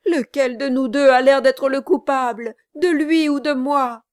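high, loud, fervent protest of innocence; impassioned outcry. A narrator's reading. Kit swears to the gentlemen it is a plot, impassioned outcry. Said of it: "s'écria Kit, éclairé tout à coup".

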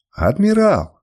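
high shout of Адмирал!; distant shout. A narrator's reading. A follower calling after the leaving admiral, shouting. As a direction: cry Адмирал! from a crowd across the hall. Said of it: "доносились до меня чьи-то крики".

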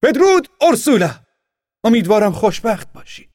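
high, loud farewell shouted after a carriage; calling farewell. Acted. Boy calls farewell to the departing girl, loud high. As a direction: call goodbye after her as she leaves. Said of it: "کوزیمو فریاد زد".

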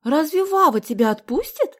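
high, loud incredulous question; amazed. A narrator's reading. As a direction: ask it in astonishment. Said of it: "изумилась Соня".

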